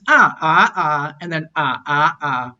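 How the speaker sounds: six Cantonese tones sung out on ah; Cantonese tone demo. Shown as a demonstration. Teacher demonstrating Cantonese tones on the syllable ah. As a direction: glide through each tone on ah. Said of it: "So in the high tone".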